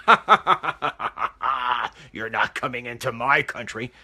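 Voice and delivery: sinister villain laugh. Retold or imitated. Imagined sinister leader gloating at refugees, mock villain laugh. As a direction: ham up a cartoon villain laughing. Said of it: "some sinister person sitting back and saying".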